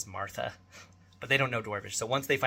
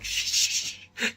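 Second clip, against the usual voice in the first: voiceless shushing; hushing the son so the wife won't hear; 6 dB louder, slower than usual. Retold or imitated. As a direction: hush him quickly. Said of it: "And I'm like".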